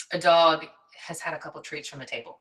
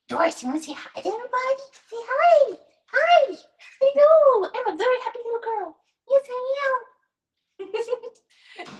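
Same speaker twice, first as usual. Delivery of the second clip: very high, fast sing-song puppy voice; 7 dB louder than usual. Real, not acted. Coaxing Doris to say hi to viewers, high baby talk.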